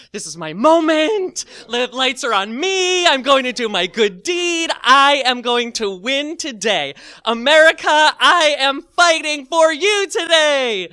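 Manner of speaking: high, loud, stretched-out proclamation; grandiose mock heroics. Teller's inner hero addressing America, mock-triumphant declaration. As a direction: proclaim it like a hammed-up champion.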